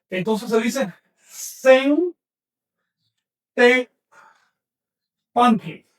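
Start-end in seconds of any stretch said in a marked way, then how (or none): 1.2-5.9 "Sen te panti" broken up with long pauses between syllables; split syllables. Shown as a demonstration. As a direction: break the word apart so the class hears each piece. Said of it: "Observen cómo he dividido el término".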